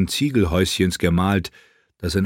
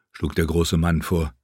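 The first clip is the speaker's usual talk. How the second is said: ordinary delivery, nothing marked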